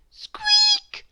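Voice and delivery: very high squeaky mouse cry; tiny mouse squeak. A narrator's reading. Mouse squeaking at the sleeping man. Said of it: "he squeaked".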